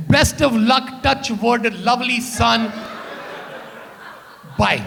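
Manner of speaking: put-on loving mom in a thick Punjabi accent; mom's Punjabi accent. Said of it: "and she's like".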